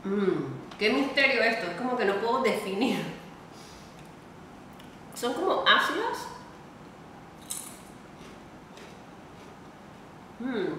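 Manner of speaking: talking with food in mouth; speaking between bites; chewing while talking. Real, not acted. Seen 2: Chip in hand near mouth, had just bitten; mouth moving as if chewing.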